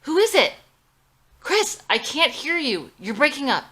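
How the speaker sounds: very loud, high shouts; screaming at the door and into the phone; frantic shouting. Acted. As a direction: yell at the door and phone at once. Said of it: "screams into the phone and at the door simultaneously".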